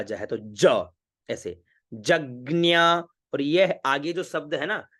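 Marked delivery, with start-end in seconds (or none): 1.9-3.0 जज्ञा drawn out slowly, with "gnya" in the second syllable; pronunciation model. Shown as a demonstration. Teacher shows students the word, slow model pronunciation of जज्ञा. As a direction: slowly say जज्ञा as jag-gnyaa. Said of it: "इसको हम उच्चारण करेंगे ज्ञा".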